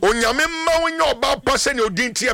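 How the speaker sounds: fervent prayer. Real, not acted. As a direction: proclaim the blessing with conviction. Prays for a caller on air.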